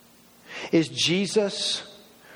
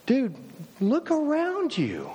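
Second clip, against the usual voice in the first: pitched-up, drawn-out disbelief; exasperated Jesus; 8 semitones higher than usual. Pretend. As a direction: stretch it out high, as if it were obvious. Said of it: "If I'm Jesus, I'm going".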